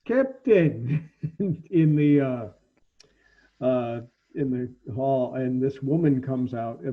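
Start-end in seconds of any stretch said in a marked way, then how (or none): 0.1-5.3 yelling. Acted. Dragon creature summoning the captain from the deck, yelling.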